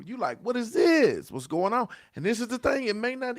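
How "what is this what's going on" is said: high, loud puzzled asking; what on earth is happening to me; startled bewilderment. Retold or imitated. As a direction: suddenly caught off guard by your own tears. Feeling tears come out of nowhere.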